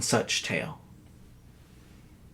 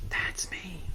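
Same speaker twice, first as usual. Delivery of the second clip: soft breathy whisper, much softer; whispered aside; 4 semitones higher, 14 dB quieter than usual. Real, not acted. Host whispering his own name to viewers, quiet aside. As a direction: lean in and whisper it like a secret.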